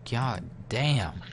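usual speaking voice

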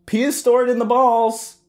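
very high, loud taunting yell; high-pitched goading shout; yelled back. A narrator's reading. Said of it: "I yelled back at him".

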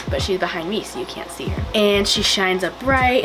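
usual speaking voice

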